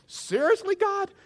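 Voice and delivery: very high, incredulous squeak; hammed-up appeal to heaven; exasperated disbelief. Man finding complaint emails, protesting to God in disbelief.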